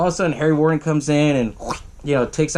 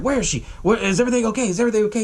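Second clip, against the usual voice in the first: mock frantic questioning; 7 semitones higher, faster than usual. Axel frantic after the attack.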